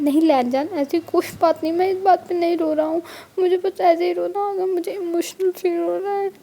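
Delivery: mild crying voice, trying to hide it; teary voice. Acted. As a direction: speak through welling tears. Wing crying in partner's arms.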